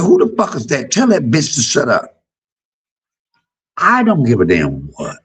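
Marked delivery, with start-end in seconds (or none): 0.0-2.2 high, indignant mock-mama outburst; imitating a fed-up mother; mama's angry cursing. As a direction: snap it like a mother cut off on the phone.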